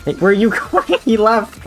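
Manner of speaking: high surprised laugh. Real, not acted. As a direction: call after him in shock. Boss suddenly leaves.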